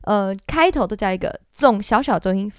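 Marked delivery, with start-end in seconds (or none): none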